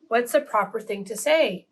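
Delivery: usual speaking voice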